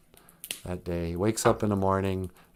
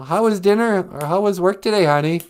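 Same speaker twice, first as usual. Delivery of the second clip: lightly voiced wife, pitch lifted; mock wife; 8 semitones higher, 4 dB louder than usual. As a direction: greet a husband in a raised voice. Wife greeting husband, acted.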